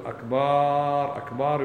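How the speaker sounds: أكبر with the first vowel stretched very long; drawn-out akbar. Shown as a demonstration. Teacher mocking the stretched akbar, slow. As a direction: pull out the a of أكبر like a drum beat. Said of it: "يقال أنه طبل".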